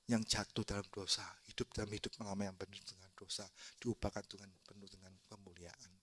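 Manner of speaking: low, soft, solemn reflection; hushed testimony. Real, not acted. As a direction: drop right down into quiet reverence. Preacher's hushed testimony after shouting.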